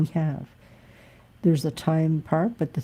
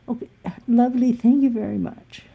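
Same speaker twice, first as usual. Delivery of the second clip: high, fast syrupy sign-off; mock sugary lady; 7 semitones higher, 4 dB louder than usual. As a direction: rattle off her fake-polite goodbye, pitched up. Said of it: "her last words were".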